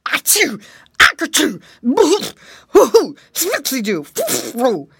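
loud, high comic sneezes; hammed-up volley of nonsense sneezes; acted sneezing fit. A narrator's reading. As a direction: sneeze explosively like a cartoon alligator. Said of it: "sneezed the alligator".

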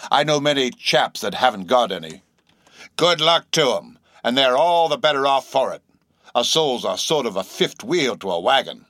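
rambling sailor stranger; eccentric old sailor, dismissive. A narrator's reading. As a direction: wave it away as a cranky old salt.

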